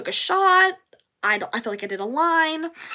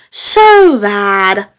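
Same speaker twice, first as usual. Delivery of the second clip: suddenly loud; loud burst of guilt; emphatic; 3 semitones lower, 16 dB louder than usual. Real, not acted. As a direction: blurt it out much louder. Stressing how bad she felt.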